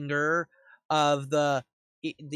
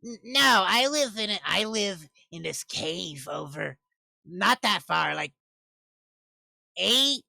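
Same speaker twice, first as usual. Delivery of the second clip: lightly voiced eccentric witch, pitched up in places; halting, higher old-witch voice; old crone witch; 7 semitones higher, 5 dB louder than usual. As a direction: play a crone groping for the measurement.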